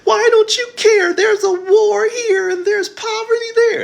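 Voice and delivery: very high, loud, slow wailing shout; mock outraged crier. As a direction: parody the hysterical activist. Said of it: "you ain't got time for all that bullshit".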